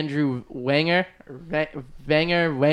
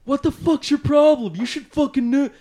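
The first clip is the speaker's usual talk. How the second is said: retold angry retort; 10 semitones higher than usual. The producer snapping back at him, retold as a mock angry retort. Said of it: "he's like".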